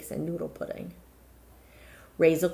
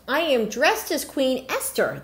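amazed; 7 semitones higher than usual. A narrator's reading. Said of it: "she gasped".

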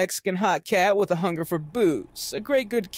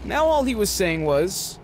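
usual speaking voice

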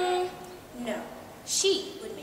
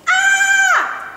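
high, loud falling scream; 20 dB louder than usual. A narrator's reading. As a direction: scream as you are thrown. Pupil thrown to sharks.

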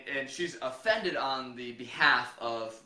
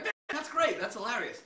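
higher-pitched mock approval; gushing partygoers; 7 semitones higher than usual. Partygoers reacting to an ignorant costume, mock delight. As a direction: imitate easily amused partygoers, pitched up.

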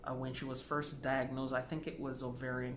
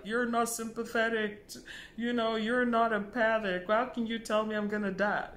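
higher, louder hurt protest; upset protest; 8 semitones higher, 6 dB louder than usual. Retold or imitated. Patient to blunt doctor, upset and louder. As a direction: throw the hurt back at him. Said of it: "she was very upset".

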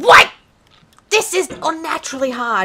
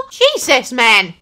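ordinary delivery

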